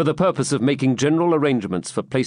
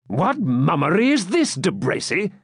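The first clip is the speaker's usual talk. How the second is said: sharp, raised challenge to a friend; somewhat angry; 5 semitones higher than usual. A narrator's reading. Knight confronts De Bracy in disguise, somewhat angrily. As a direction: demand an answer, a touch angry. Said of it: "said Fitzurse somewhat angrily".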